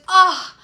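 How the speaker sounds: high, loud, drawn-out "oh"; mock a touched, sympathetic "oh". Bartender touched by her offer, imitated high "oh".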